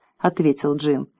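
ordinary delivery, nothing marked

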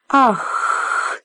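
sharp unvoiced gasp of recognition; breathy dragon gasp. A narrator's reading. Dragoness gasping at Jim's answer. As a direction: gasp like a startled beast. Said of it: "воскликнула драконица".

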